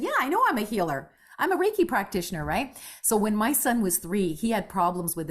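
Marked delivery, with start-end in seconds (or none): 0.0-2.4 quick, high, relieved reply; eager. Retold or imitated. Woman telling the psychic she already heals, quick and eager. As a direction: rush it out in relief. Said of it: "I said".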